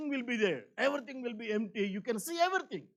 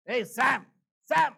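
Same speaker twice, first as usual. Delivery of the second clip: louder call to get someone's attention; 3 semitones higher, 9 dB louder, slower than usual. Retold or imitated. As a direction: call a friend across the room, urgently. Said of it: "Whenever you are telling something".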